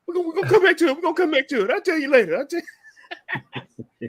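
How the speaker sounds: high, cracking voice through a teary laugh; tearing up. Real, not acted. Father tearing up on the podcast retelling telling his son, voice cracking. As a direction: laugh and choke while putting it off. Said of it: "I see you tearing up".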